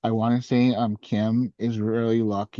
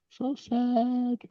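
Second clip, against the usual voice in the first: mock sad squeak; 12 semitones higher, 4 dB quieter than usual. Mock sorrow after read.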